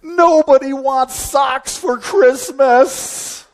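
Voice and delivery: high, drawn-out tantrum cry; mock angry scream. Speaker re-enacting his childhood fit over a gift, mock screamed outrage. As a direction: wail it like an outraged kid, stretching the words. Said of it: "And then I scream loudly".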